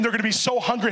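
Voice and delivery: ordinary delivery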